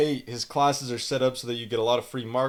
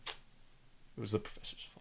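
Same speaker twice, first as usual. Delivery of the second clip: breathy mocking aside; sarcastic whisper; 4 semitones lower, 18 dB quieter than usual. Vlogger mocking blame-shifting students in a sarcastic whisper.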